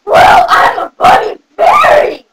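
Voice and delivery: loud, high, slow and strained; odd bunny fairy voice. Acted. Bunny fairy insisting to a doubting child, odd strained voice. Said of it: "Why do you talk like that?".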